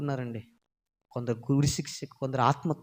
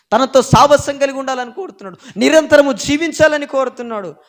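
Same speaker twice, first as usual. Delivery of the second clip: louder, higher pulpit preaching; fiery preaching; 10 semitones higher, 15 dB louder than usual. Acted. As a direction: drive it home to the congregation. Preaching at full force.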